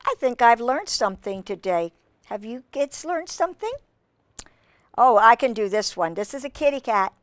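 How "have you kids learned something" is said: ordinary delivery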